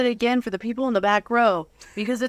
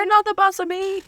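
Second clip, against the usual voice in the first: high, louder whiny mock retort; mock defiant teen; 11 semitones higher, 5 dB louder than usual. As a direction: play a sulky teenager talking back. Playing along as defiant teen.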